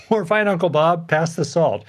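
amused humouring. Acted. Humouring Uncle Bob at the table, amused and letting it slide. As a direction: let it go with an easy, high-pitched chuckle.

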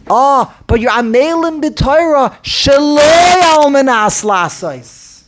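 loud mock objection. Imitating an objector to the listener, loud and slow. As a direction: call out the objection as if shouting it across the room. Mocking objector quoting Rashi.

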